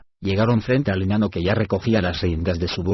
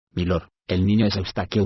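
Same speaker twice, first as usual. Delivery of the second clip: usual speaking voice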